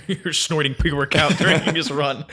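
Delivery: amused and giggling; laughing. Real, not acted. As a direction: say it through laughter. Laughing at story.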